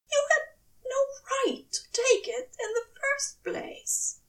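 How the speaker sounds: high, tear-choked scolding; tearful reproach. A narrator's reading. Aziraphale scolds Crowley, high and tearful. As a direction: reproach him through tears.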